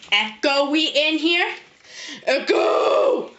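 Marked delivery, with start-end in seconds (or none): 2.2-3.3 drawn-out wailing "echo" call; dying whale sound. Real, not acted. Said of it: "like a dying whale sound".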